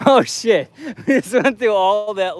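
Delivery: high laughing. Real, not acted. Vlogger reacting to a sign on his walk, laughing high.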